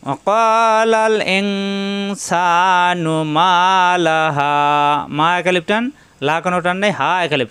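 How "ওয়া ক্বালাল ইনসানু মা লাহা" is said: slow melodic chant of the verse; Quran recitation. Shown as a demonstration. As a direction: intone it drawn out.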